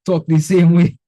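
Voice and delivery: ordinary talk, nothing marked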